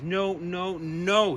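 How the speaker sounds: high, loud furious no; loud high rage; raging scream. A narrator's reading. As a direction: scream it at the storm cloud. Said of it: "he screamed with rage".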